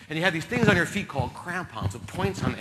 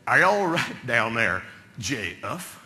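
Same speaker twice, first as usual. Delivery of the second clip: low-pitched cowboy calling from above; McAndrews yelling down; 6 semitones lower, slower than usual. Acted. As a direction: call down deep, stretching the name into two syllables. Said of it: "you hear McAndrews yell down".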